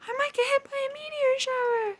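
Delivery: very high, loud frightened squeak; scared Uranus. A narrator's reading. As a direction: squeak it in terror. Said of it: "Uranus was too scared".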